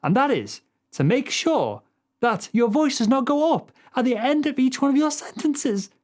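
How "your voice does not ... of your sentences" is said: high, uncertain upward lilt through to "sentences"; voice rising at sentence end. Shown as a demonstration. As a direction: lift "sentences" into a high, questioning pitch. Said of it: "Because when you do this".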